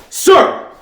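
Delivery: high, loud soldier's reply; military response. Acted. Private answers his officer's orders, loud and military.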